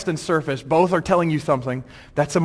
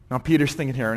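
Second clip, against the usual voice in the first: ordinary talk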